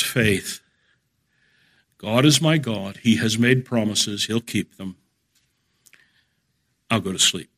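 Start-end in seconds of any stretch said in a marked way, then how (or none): 2.0-7.5 quietly assured, winding down towards sleep; calm assurance. Acted. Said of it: "It's simply the calm assurance".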